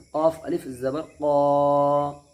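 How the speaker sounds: heavy rounded क़ा, held long and loud; full-mouthed qaf. Shown as a demonstration. Teacher showing a full letter, thick slow qaf. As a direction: say क़ाफ thick from back of mouth, stretch क़ा. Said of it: "मोटा करके पढ़ना है".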